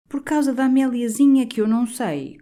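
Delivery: lightly voiced timid curate, a bit high; timid coadjutor. A narrator's reading. Curate objecting timidly to the canon's plan, hesitant. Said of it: "considerou timidamente".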